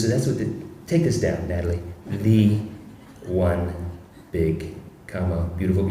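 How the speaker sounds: lightly voiced Trump giving orders; Trump dictating. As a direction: spell it out word by word like Trump. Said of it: "and he says it".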